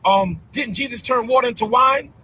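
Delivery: imitating club-goers calling out high; followers calling out to Bishop. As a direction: pipe up like a follower hailing the bishop. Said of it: "and they always say".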